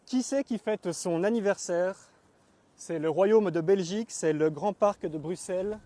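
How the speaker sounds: performed verse, pitched above everyday talk; poem recitation. Acted. Reads own poem aloud.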